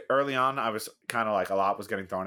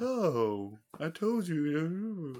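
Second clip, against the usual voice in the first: mock British accent, high and drawn out; hammed-up, slow, high-pitched British impression; over-the-top British accent; 9 semitones higher, much slower than usual. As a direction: imitate weird British narration, stretching every word slowly. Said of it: "doing a very over-the-top British accent".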